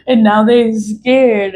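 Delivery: slow, high mocking jeer; scornful sing-song taunt. Real, not acted. Mocking repeat after laugh.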